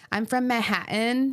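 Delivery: slow, drawn-out mock Manhattan accent; New York accent. Imitating her friend from Manhattan, New York accent. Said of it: "I have to do it in her accent".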